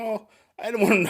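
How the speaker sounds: high, airy put-on voice; ditzy airy voice. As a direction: imitate a sweet, ditzy woman. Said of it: "airy voice".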